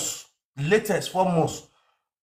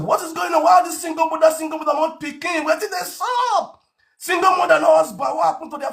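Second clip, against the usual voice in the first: exasperated; 12 semitones higher than usual. Retold or imitated. Asking why single mothers are everywhere, high and exasperated. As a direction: demand an answer, fed up. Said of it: "I said".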